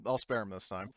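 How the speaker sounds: ordinary talk, nothing marked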